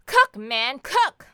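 loud order rising to a high yell; escalating demanding yell; shouted order. Acted. Repeating the demand to cook.

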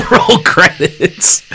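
repeating it through laughter; laughing. Real, not acted. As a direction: laugh out the words slowly. Laughing while echoing the punchline.

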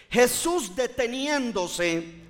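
usual speaking voice

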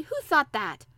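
put-on affronted boy's outburst; angry. A narrator's reading. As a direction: demand to know who dared. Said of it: "said Colin angrily".

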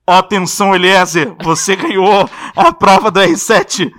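loud, drawn-out prank announcement; imitated booming official announcer; voice of God. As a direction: proclaim the mission as an unseen voice. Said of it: "só saiu uma voz".

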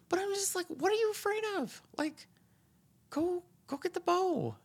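very high, incredulous outburst; exasperated squeak. Real, not acted. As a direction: shriek in disbelief. Ranting, quoting himself.

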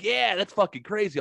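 high, amazed "that's fucking crazy"; hammed-up astonishment; mock amazed reaction. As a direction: imitate strangers caught off guard. Imitating the strangers reacting.

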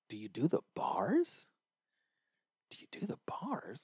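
whispered, baffled, to himself; inner-voice whisper. Retold or imitated. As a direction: mutter it under your breath in disbelief. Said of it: "in my head I'm like".

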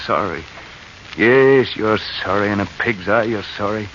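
low, soft, bitter to himself; bitter muttering. Acted. Spurned friend alone on the corner, bitter mutter. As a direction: grumble the betrayal under your breath.

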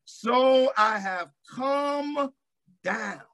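high, slow and drawn out; preaching shout. Acted. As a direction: let the words ring, then drop. Preacher climax quoting God.

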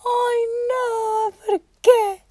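high whiny laugh-cry; laughing lament. Real, not acted. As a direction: plead why through laughter. Laughing lament over necklace.

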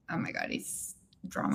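hushed whispered aside; whispering. Real, not acted. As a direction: whisper it behind your hand. Gossiping aside about him.

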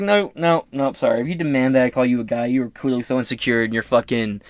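normal talking voice

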